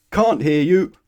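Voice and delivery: loud, high thundering reply. Acted. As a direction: blast it at him. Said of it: "thundered the grocer".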